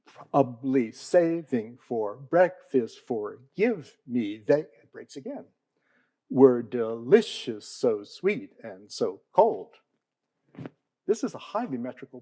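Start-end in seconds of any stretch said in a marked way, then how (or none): 0.0-4.4 slow, every beat of "I have eaten the plums" marked as anapests; scanned metrical reading. Shown as a demonstration. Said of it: "it's ten anapes and two iambs". 6.3-9.8 slow, every beat of "I have eaten the plums" marked as anapests; scanned metrical reading. Shown as a demonstration. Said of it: "it's ten anapes and two iambs".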